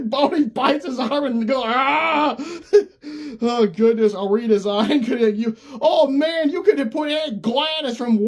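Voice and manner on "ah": imitated yelp. Dog bites arm, yelp.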